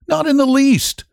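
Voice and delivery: an eager guest reassuring his host; Rainsford's reply. A narrator's reading. Rainsford replies to the general.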